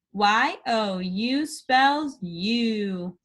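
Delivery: letters spelt out slowly; slow spelling. Shown as a demonstration. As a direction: spell each letter with a pause. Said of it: "spell it".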